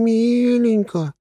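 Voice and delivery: drawn-out sweet, high; cute. Acted. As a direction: savour the cute word. Quoting cute word, very slow. (умильно растягивая)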